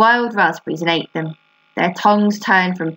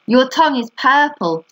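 higher-pitched, restrained mock scream; mild narrated scream; 6 semitones higher than usual. A narrator's reading. Fern teases Avery about the tongue, mild scream. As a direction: call it out high, without real screaming. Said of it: "screamed Fern".

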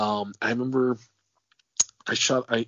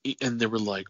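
ordinary delivery

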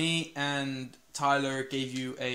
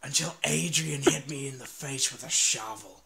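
lightly voiced goofy character; a touch of a dim character voice; put-on impression voice. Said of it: "I used to talk normal".

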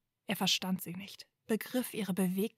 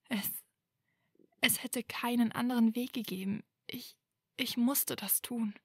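halting, higher voice on the edge of tears; near sobbing; 4 semitones higher than usual. A narrator's reading. Hermione near tears justifies herself to Draco. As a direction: stammer it out almost crying. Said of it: "schluchzte fast".

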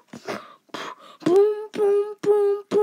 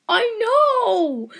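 normal talking voice

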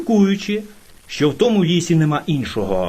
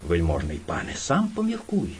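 ordinary delivery

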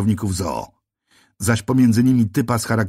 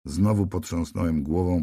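plain reading voice

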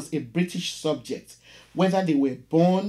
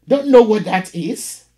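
much louder, higher impatient question; clanging landlady; 6 semitones higher, 10 dB louder than usual. Acted. Landlady impatient with the caller on the phone, loud. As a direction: fire it back hard and loud. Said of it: "clanged her accent".

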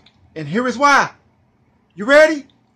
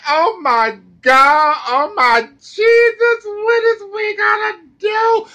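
mock crying miners; 8 semitones higher than usual. Mocking the coal miners now worried, high whiny wail. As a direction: ham up a miner wailing in despair. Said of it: "But now they wanna cry".

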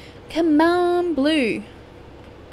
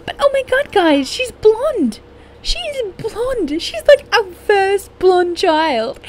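thrilled; 11 semitones higher, 4 dB louder, slower than usual. Real, not acted. Still thrilled about blonde child.

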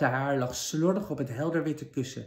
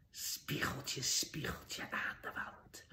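much softer breathy whisper, slow; 11 dB quieter, slower than usual. Acted. Evil queen whispers her question to the magic mirror. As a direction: breathe the charm to the mirror in secret.

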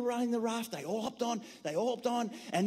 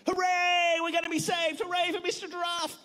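high loud hooray; high excited shout; cheering hooray; 7 semitones higher, 4 dB louder than usual. Acted. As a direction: cheer the rescue. Animals cheer giraffe.

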